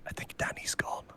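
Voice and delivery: hushed conspiratorial whisper. Real, not acted. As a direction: whisper it like he might overhear. Whispering that Danny left.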